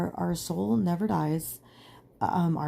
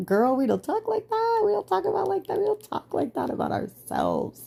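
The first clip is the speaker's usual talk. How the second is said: high-pitched, put-on voice of her own brain; scolding inner voice; 13 semitones higher than usual. Brain correcting her for calling herself dumb, high scolding voice. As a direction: squeak a telling-off, far above usual pitch. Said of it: "my brain was like".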